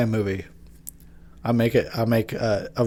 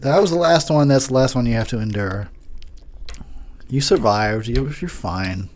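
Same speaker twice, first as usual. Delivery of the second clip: ordinary delivery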